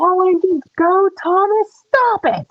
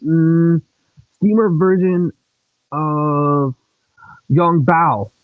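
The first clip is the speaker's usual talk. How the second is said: much lower put-on engine voice; deep engine voice; 12 semitones lower, slower than usual. Child voicing big brother engine teasing little brother, deep and low. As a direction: rumble it out like a big old steam engine.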